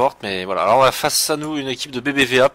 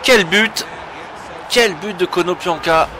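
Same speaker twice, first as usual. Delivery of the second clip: loud, high goal exclamation; excited sports commentary; 8 semitones higher, 6 dB louder, slower than usual. Real, not acted. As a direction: shout it as the ball hits the net. Opponent scores a great goal.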